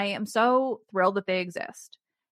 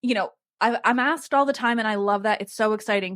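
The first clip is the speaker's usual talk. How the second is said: ordinary delivery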